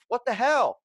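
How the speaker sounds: high, loud exasperated yell; exasperated outburst. Retold or imitated. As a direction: blurt it out in disbelief. Catching cousin in freezer.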